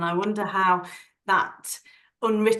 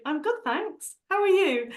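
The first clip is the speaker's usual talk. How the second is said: high, bright put-on cheer; fake cheerful; 11 semitones higher than usual. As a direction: mask it with a chirpy reply. Forced cheerful reply.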